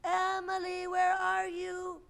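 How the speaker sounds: high, slow calling voice. A narrator's reading. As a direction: call out across the field. Calling for lost Emily.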